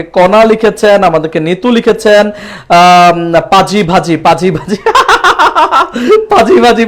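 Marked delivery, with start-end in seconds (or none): 4.8-6.2 high, helpless giggling; burst of laughter. Real, not acted. Reacts to funny viewer name. 6.3-6.9 higher, laughing through the words. Real, not acted. Still laughing at funny name.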